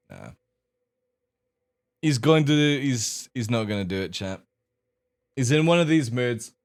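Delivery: ordinary talk, nothing marked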